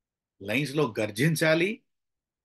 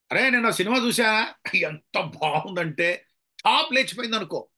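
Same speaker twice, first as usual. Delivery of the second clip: gushing, raised voice; excited raving; 5 semitones higher than usual. Shown as a demonstration. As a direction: rave about it to a friend at full energy. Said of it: "అని అనుకోండి, అది కూడా బానే ఉంది".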